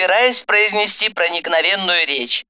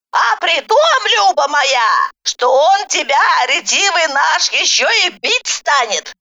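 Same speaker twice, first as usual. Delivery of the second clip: ominous snide granny; 9 semitones higher than usual. A narrator's reading. Granny character warns. (ехидная бабка нараспев)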